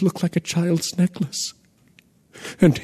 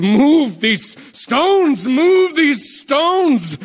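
much higher, slow frantic screaming of the order; mad scream; 12 semitones higher, much slower than usual. A narrator's reading. King screaming to the slaves at the tomb, frantic mad scream. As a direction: scream it like a man gone mad. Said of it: "He screams suddenly like he's gone mad".

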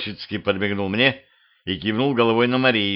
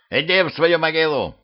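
raised, higher-pitched order; yelling; 7 semitones higher than usual. A narrator's reading. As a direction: shout an intruder away. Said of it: "заорал депутат".

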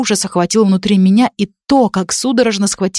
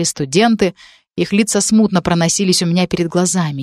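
ordinary delivery, nothing marked

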